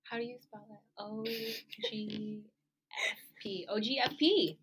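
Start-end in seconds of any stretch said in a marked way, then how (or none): 1.0-3.7 "O G F P" letter by letter, drawn out; slow, deliberate spelling of "O G F P"; spelled out slowly. Shown as a demonstration. Spells acronym out after being asked. 3.7-4.6 "O G F P" in one quick, louder go; brisk, emphatic "O G F P" without gaps; run together briskly. Shown as a demonstration. Repeats spelled acronym as a name.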